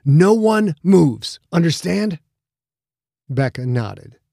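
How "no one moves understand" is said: slow, loud, higher warning; stern warning. Acted. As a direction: lay down the law, slowly. Captain warns before armed boarders.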